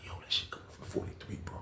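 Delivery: hushed whisper; whispering to his cellmate at night. Retold or imitated. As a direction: whisper so the neighbors can't hear. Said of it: "I was like".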